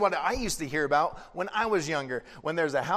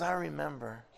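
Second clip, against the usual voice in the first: soft, lower, fond remembering; tender Jesus; 3 semitones lower, 7 dB quieter than usual. Retold or imitated. Jesus answering Peter softly, quiet and tender. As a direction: recall it gently, quietly. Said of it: "And Jesus goes".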